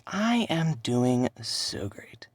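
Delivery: very slow, strained whisper; drawn-out whisper. Acted. Answering people who ask how he is doing, slow whisper. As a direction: force out a pretend-fine answer in a slow whisper. The fake answer he usually gives.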